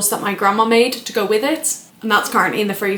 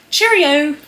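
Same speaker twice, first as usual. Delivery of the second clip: high British sing-out; 7 semitones higher, 5 dB louder than usual. Acted. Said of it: "so stereotypically British".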